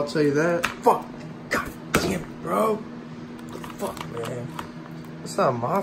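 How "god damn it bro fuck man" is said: whispered curse. Real, not acted. Defeated muttering after rage.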